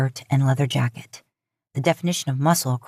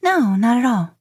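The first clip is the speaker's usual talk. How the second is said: higher, casual brush-off; breezy; 7 semitones higher, 3 dB louder than usual. A narrator's reading. Nervous woman faking breeziness to her date. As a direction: try to sound unbothered. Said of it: "in a hopefully breezy kind of way".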